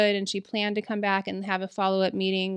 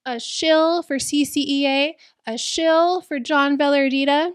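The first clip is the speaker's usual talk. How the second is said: raised, louder mock yell at a colleague; retold shouting; 6 semitones higher, 6 dB louder than usual. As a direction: imitate an angry shout, higher and louder.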